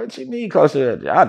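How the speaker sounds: retold bewildered challenge; retold incredulous question. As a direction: question it with rising disbelief. Retold disbelief at the hint.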